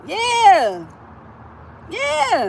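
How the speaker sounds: loud, high, defiant yeahs. Real, not acted. Answering critics who call her cold, loud defiant yeahs. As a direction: own it loudly.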